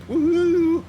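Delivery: loud, high whoop of joy; excited whoop. Real, not acted. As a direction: cheer as the wheels leave the ground. Takeoff cheer.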